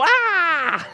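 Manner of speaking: sudden high yelp of alarm; high startled cry. Retold or imitated. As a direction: jump with a sharp yelp of fright. Startled by officer calling his name.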